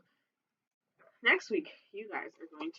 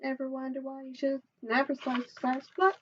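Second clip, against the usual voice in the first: ordinary delivery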